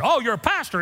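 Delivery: high, eager imitation of an amazed stranger; mock surprise. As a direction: leap up in pitch at the discovery. Mimicking stranger's surprise at pastor.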